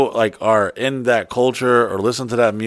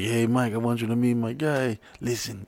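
softly imitating a friend with a Latin accent; quiet mock Latin accent; put-on Latin accent; 10 dB quieter than usual. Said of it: "Carlos has zero Latin accent".

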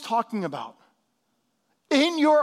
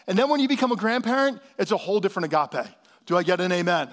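ordinary talk

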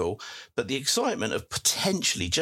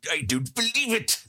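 Victor Meldrew impression; 12 semitones higher than usual. Host mimics the sitcom grump for a fan's picture, badly. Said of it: "picture of Victor Meldrew with".

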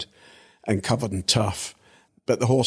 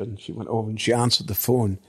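ordinary delivery

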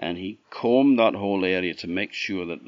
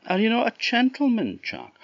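put-on girl's voice; 6 dB louder than usual. A narrator's reading. Girl voiced pleading with Jack.

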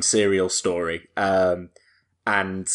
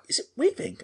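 softly imitating a whispered cinema question; high, soft, wondering murmur; hushed question; 6 dB quieter, faster than usual. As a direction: ask it quietly, pitched up high. Retelling hushed exchange in cinema.

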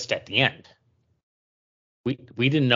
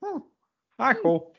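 gruff try that turns into a Kermit voice; a failed gruff voice sliding into Kermit; Kermit the Frog; 9 semitones higher than usual. Shown as a demonstration. [name] shows his gruff voice sounds like Kermit, comic and light. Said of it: "it just comes off like I'm Kermit the Frog".

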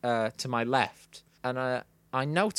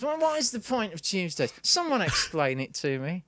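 mock stand-up delivery, starting high-pitched; open mic comic; 4 semitones higher than usual. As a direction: parody an amateur comedian posing a question. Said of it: "sort of open mic night".

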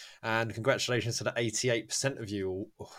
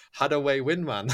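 higher, louder mock Geordie; punchline in a raised Geordie accent; 6 semitones higher, 8 dB louder than usual. Shown as a demonstration. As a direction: put on a hammed-up Geordie accent. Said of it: "say two of these in a Geordie accent".

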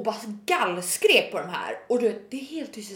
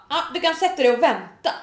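higher, louder mock brush-off; dismissive; 5 semitones higher, 6 dB louder than usual. Salon staff to booked customer, mock dismissive. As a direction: imitate staff sending a customer to wait.

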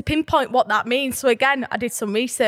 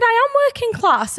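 much higher, insistent, stressed on am; defiant insistence; 10 semitones higher, 3 dB louder than usual. Retold or imitated. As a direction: stand your ground firmly. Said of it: "I said".

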